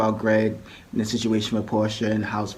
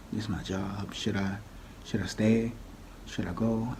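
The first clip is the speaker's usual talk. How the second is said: much softer timid questions; scared producer; 10 dB quieter than usual. Retold or imitated. Nervous producer asking Nene whether to stay, much softer. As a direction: ask meekly, afraid of being yelled at.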